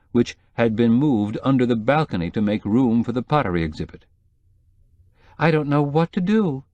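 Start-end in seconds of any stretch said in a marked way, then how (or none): 5.4-6.6 whimpering. Acted. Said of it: "she was whimpering".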